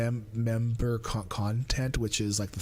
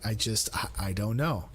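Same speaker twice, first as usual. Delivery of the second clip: normal talking voice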